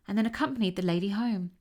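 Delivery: ordinary delivery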